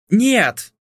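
sharp high shout. A narrator's reading. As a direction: cry out no. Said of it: "закричала я".

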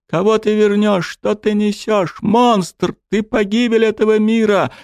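high furious sobbing yell; angry tearful shout. Acted. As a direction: hurl every accusation, crying. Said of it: "зло вперемешку со слюнями и соплями кричала Элина".

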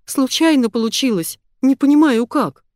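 high, plaintive excuse; moaning. A narrator's reading. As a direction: whine it as if queasy. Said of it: "простонала я".

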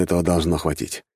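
plain reading voice

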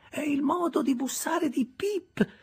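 put-on high, slow old woman's voice; an aged recluse recognizing a knock; old Miss Havisham. Miss Havisham recognizes Pip's knock from her room, slow and high.

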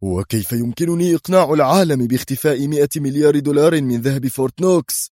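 high, agitated, indignant question; mild angry shout of disbelief; outraged shouting. A narrator's reading. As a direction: protest hotly without a full yell. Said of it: "ووزير دفاعه يصيح في ثورة".

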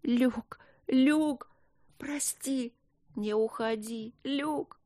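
high, soft, breathy begging; muffled desperate pleading. Acted. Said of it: "приглушённое, отчаянное, молящее".

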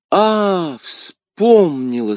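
high, long-drawn realisation; sudden recollection. Acted. Poisoned woman suddenly recalls the name, drawn-out realisation. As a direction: stretch it as memory returns.

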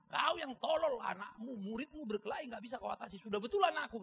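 loud, high-pitched mock tirade; imitated angry scolding. Father defending his son to the teacher, imitated scolding. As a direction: play an irate father telling someone off. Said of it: "gurunya dimarahin lagi".

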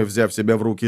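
plain reading voice

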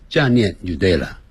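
ordinary delivery

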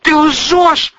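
high, loud cry of outrage; shouted accusation. A narrator's reading. Daughter shouts at mother over a lie, outraged. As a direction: shout it out, pitch leaping up. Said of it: "выкрикнула Лиля".

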